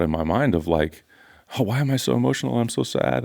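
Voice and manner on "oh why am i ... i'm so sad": higher, softer, quick mock lament; half-hearted imitation of being upset; mock sadness. Mock quote of an absent reaction.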